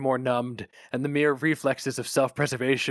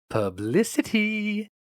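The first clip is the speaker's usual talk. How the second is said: higher, slow and sugary; sweet phone greeting; 12 semitones higher, 4 dB louder, much slower than usual. Acted. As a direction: purr the word into the phone like a salesman. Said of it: "said Flammer sweetly".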